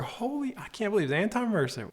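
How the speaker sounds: high, excited disbelief at a celebrity; starstruck fan. Retold or imitated. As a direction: gush over meeting a star. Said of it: "he goes".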